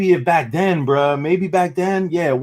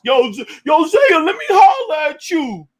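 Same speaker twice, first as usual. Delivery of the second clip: high, slow pleading call; hammed-up needy shout; mock begging; 11 semitones higher, 3 dB louder, slower than usual. Mocking Sai begging later.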